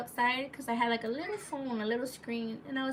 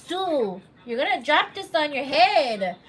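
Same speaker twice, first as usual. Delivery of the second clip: startled loud scolding; 4 semitones higher, 11 dB louder, slower than usual. Real, not acted. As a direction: call out sharply before it falls. Scolding her child mid-story.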